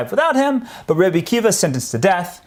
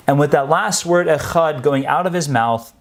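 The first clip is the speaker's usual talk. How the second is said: ordinary delivery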